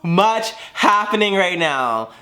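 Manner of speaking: high, slow, overwhelmed complaint; retold freak-out. Freaking out as ambulances come, retold overwhelm. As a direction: act out being overwhelmed, stretching it out. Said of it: "I was fucking freaking out because".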